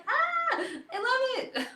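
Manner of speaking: very high, laughing delight; delighted squeal. Retold or imitated. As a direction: re-enact a thrilled reaction, squealing. Retold delighted reaction, laughing.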